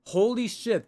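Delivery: ordinary talk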